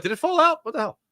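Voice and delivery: high-pitched, softly incredulous aside; mock bafflement. Host retelling his confusion over the vanished pencil, high and baffled. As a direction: react puzzled, pitched well up. Retold puzzlement at missing pencil.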